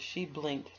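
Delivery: usual speaking voice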